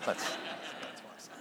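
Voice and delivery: sneaky whispered confession; whispered aside. Real, not acted. Pastor jokingly whispers his own retort to the congregation. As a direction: breathe it like a secret joke.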